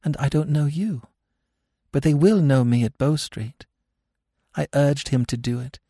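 high, emotional confession building in pitch; growing emotion. A narrator's reading. Captive woman confessing to Smith, growing emotion. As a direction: let the distress build as you confess. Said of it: "her emotion growing momentarily greater".

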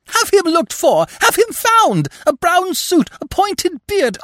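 very high, loud shriek. A narrator's reading. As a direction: shriek orders at the police. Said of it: "shriek at the top of her voice".

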